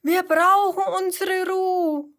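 calling out. A narrator's reading. Meadow animals calling to the noisy kangaroo, loud and high. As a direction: shout it like a crowd of animals. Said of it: "Alle Tiere der Wiese rufen".

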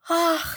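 hoher, lauter Seufzer; seufzen. A narrator's reading. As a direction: schwer aufseufzen. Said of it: "seufzt Seppel". (Seppel seufzt vor Langeweile)

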